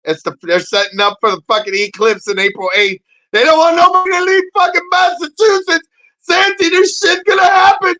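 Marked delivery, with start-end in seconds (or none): 3.3-7.9 high, loud comic rant; hyped rant. Real, not acted. Streamer ranting to chat about the eclipse, high shouting. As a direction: shout it like outrage.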